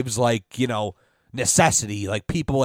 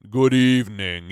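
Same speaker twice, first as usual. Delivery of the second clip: Lurch impression; 5 semitones lower than usual. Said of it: "remember Lurch?".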